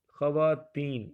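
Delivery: put-on خواتین said slowly as kha-wa-teen; correct pronunciation. Shown as a demonstration. Teacher models the right خواتین for students. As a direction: draw out خواتین with a clear kh. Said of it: "یہ لفظ جو ہے یہ درست ہے".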